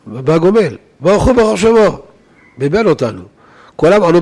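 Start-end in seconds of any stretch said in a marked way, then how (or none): 1.0-2.0 higher-pitched mock shout; imitated shout. Moroccan congregant calling out the response, mildly mock-shouted. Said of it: "צועק כל רם".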